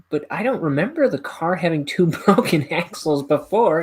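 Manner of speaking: high, loud protesting wife; Marge. Retold or imitated. Wife questions Homer about the broken axles, high imitated voice. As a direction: imitate a cartoon wife's puzzled objection.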